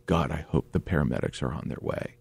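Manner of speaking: soft, low retold prayer; hushed inward hoping; muttered prayer. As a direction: say it under your breath, low.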